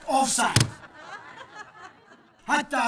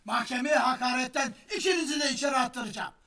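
ordinary talk

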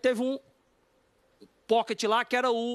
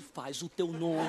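low, slow, hushed goading; imitated friend quietly winding him up; sly egging-on; 6 semitones lower, 5 dB quieter, much slower than usual. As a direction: tempt him softly, stretching each word. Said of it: "o Tom dando corda".

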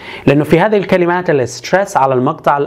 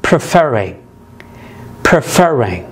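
preferring with FER stressed; preferring said as pre-FER-ring; stress on second syllable; much slower than usual. Shown as a demonstration. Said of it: "تضاعف حرف ال R".

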